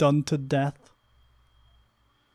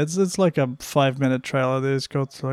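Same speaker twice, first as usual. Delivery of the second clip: ordinary delivery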